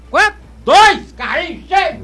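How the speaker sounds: high, loud amazed outburst; can't believe the prize; surprised shout. Acted.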